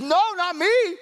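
high-pitched, acted disbelief; flabbergasted Peter. Disciple denying he would betray Jesus, flabbergasted. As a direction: protest in a hammed-up, high, astonished voice. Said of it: "Peter is just flabbergasted".

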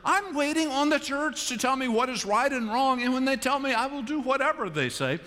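high-pitched parody of an eager follower; high, insistent mock sincerity; mock obedience. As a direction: recite it as an absurd, over-earnest pledge. Mocks imagined obedient outsider.

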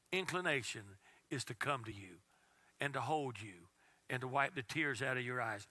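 soft and low, almost whispered; hushed, tender preaching. Real, not acted. Tender image of comforting father.

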